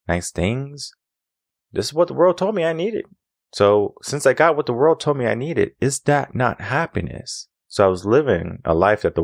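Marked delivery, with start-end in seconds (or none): 1.7-7.5 rhetorical rise. Real, not acted. Rising rhetorical build.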